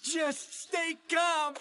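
high, drawn-out urging; tense alarm. Acted. Brock faces the herd of Stantler, high strained alarm. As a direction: urge calm while panicking, stretching each word. Herd looms behind them.

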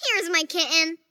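ordinary delivery

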